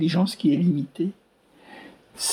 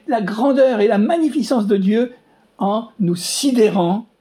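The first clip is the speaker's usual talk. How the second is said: louder preaching in full flow; fervent preaching; 4 semitones higher, 3 dB louder than usual. Real, not acted. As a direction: drive the message home to the congregation.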